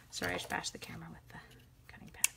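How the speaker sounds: hushed whispered apology; whispering. Real, not acted. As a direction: whisper it quickly, sorry. Apology after bashing camera.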